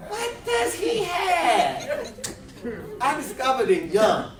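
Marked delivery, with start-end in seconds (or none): 0.1-2.1 mock disbelief. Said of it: "I be like".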